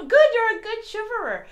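high, loud, laughing praise. Real, not acted. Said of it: "can you shiver?".

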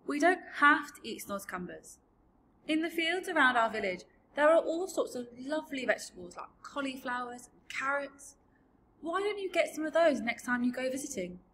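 put-on high, persuasive girl; earnest little girl. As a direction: suggest it helpfully as a young girl. Sophie suggests other vegetables.

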